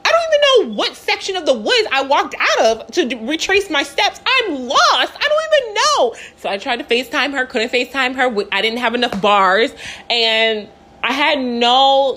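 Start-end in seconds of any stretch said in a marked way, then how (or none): 0.1-6.2 acted high-pitched distressed wail; panicked. Lost hiker calling her sister, high and panicked. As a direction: replay a panicky phone call, pitched way up.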